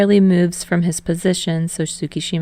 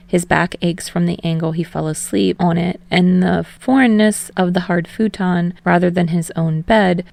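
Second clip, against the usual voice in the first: ordinary delivery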